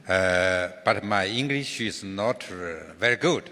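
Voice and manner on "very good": usual speaking voice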